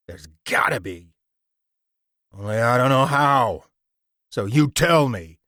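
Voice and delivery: gruff tough guy pressing; menacing. A narrator's reading. George threatening.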